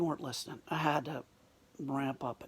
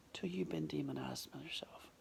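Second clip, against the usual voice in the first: whispered echo; 10 dB quieter than usual. Shown as a demonstration. Speaker repeats his point to the audience as a quiet whisper. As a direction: echo the line in a hushed whisper for weight.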